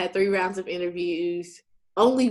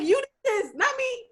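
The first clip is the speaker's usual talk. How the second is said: very high pointed comeback; sassy retort. Retold or imitated. Telling the interviewer it's on them, very high and sassy. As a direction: throw the blame back.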